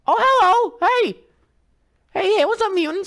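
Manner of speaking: very high, bright sing-song hello; mock friendly greeting. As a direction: greet the monsters like old friends. Greets mutants in silly voice.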